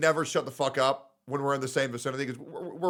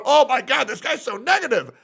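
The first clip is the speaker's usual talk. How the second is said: higher, put-on whiny complaint; mock fan voice, louder and higher; mocking disappointed viewer; 7 semitones higher, 3 dB louder than usual. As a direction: scoff like a fickle fan.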